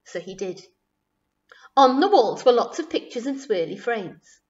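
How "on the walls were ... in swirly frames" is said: ordinary delivery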